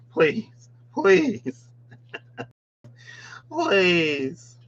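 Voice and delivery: high pleading little creature; begging crab voice. Acted. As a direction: beg desperately in a squeaky voice. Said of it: "The Lumi crab is begging you".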